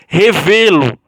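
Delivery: "Revelo" loud, weight on the VE; stress on VE. Shown as a demonstration. Teacher showing a verb plus pronoun, strong stress on VE in "Revelo". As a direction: punch the middle VE of "Revelo". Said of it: "o V sai mais forte".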